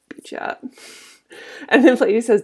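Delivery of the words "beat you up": breathy unvoiced joke under the breath; whispered aside. Teacher voicing the cobbler's joke as a whispered aside. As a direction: tuck the punchline away in a whisper.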